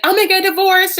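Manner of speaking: high, loud put-on threat; mock outburst. Wife threatening Michael with divorce, mock outburst. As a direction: fling it out in hammed-up upset.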